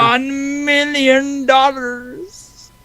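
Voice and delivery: high, drawn-out villain voice; slow, high-pitched evil mastermind; Doctor Evil. Acted. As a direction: stretch out every word like a movie supervillain. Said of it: "you are now Doctor Evil. Do the voice".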